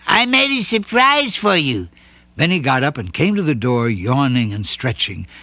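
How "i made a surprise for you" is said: higher, cheerful rabbit; proud little gift-giver; a hint of bunny voice; lightly voiced Bouncer. A narrator's reading.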